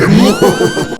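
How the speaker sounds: loud, high villain cackle; long, hammed-up evil cackle; evil laugh. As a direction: cackle at full blast like a villain. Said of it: "enter evil voice in".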